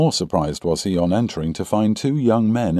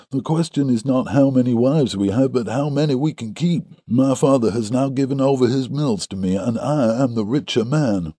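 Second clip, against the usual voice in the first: higher-pitched, boastful character voice; rival suitor Drebber; 6 semitones higher than usual. A narrator's reading. Rival suitor boasts of his wealth, higher-pitched protest. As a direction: argue it in a raised put-on voice.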